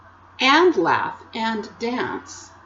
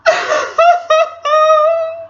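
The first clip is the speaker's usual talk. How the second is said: high, loud wailing boo-hoo; howling sob; 9 dB louder than usual. A narrator's reading. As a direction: wail in hammed-up sobs. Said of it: "began to howl".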